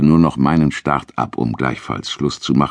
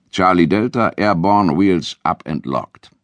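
radio through oxygen mask; 5 semitones higher than usual. A narrator's reading. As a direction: speak into a mask over the radio. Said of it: "sagte ich in meine Sauerstoffmaske".

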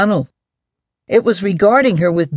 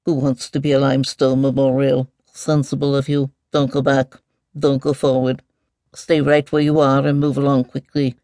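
lightly voiced, muttering mole; understated fussy muttering. A narrator's reading. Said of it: "the mole muttered immediately".

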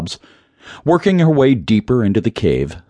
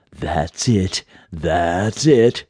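understated, voiced stage whisper; whispery mysterious voice; much slower than usual. A narrator's reading. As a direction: coax them forward as an unseen spirit. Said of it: "the mysterious voice whispered".

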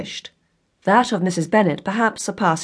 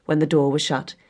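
usual speaking voice

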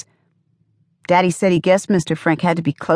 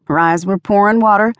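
ordinary delivery, nothing marked

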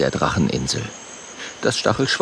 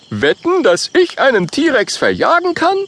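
much higher, louder brag; boastful challenge; 4 dB louder than usual. Acted. Oskar challenges Kokosnuss to a bet, higher boastful voice. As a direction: puff up and challenge.

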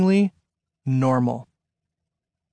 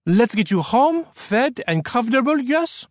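put-on high, lively French-accented woman; bright; 6 semitones higher than usual. A narrator's reading. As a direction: fuss over a guest brightly, French accent. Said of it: "said Elisa brightly".

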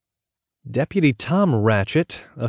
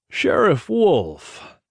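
hearty, drawn-out higher-pitched call; jovial call; 6 semitones higher, much slower than usual. A narrator's reading. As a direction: hail him cheerily across a fence, slowly. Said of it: "A deep, jovial voice called".

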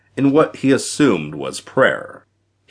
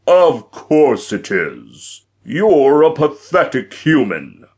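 contemptuous giant; 5 semitones higher, 4 dB louder than usual. A narrator's reading. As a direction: belittle him in a booming giant voice. Giant belittles the hero.

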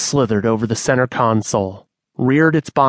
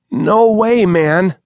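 higher, louder croaked refusal; croaking refusal; 8 semitones higher, 5 dB louder, slower than usual. Acted. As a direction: force it out with a croak. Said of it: "Manny croaked".

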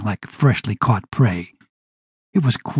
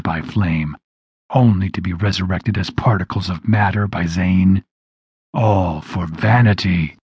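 demon threat; 4 semitones lower than usual. A narrator's reading.

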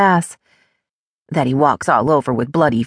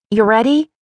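higher-pitched call to someone in another room; 6 semitones higher than usual. A narrator's reading. Amanda checking on friend after the shower, calling out. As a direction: call out a quick, raised question. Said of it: "Amanda calls out".

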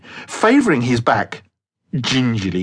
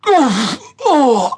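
pained grunt; 11 semitones higher, 3 dB louder than usual. Acted. Landing hard on his back.

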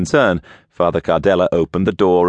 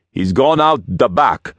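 put-on higher, accented warning; 6 semitones higher than usual. A narrator's reading. Henchman warns the boss the priest has fled, urgent warning. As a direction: alert the boss, pitched up. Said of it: "he warned Kruglov".

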